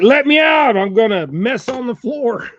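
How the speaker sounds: dog's voice. Retold or imitated. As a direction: whine like the desperate dog. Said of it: "She's like".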